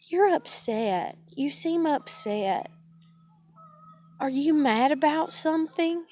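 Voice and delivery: slow, drawn-out probing, rising in pitch; mock concern. Mother asks if speaker is upset, mock concern. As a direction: imitate mother's needling questions, slow and lilting. Acting mother's prodding on coming home.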